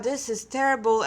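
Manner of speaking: usual speaking voice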